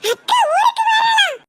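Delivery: high, slow happy shout; child shouting for joy; excited cheer. Acted. Child cheering sister is coming.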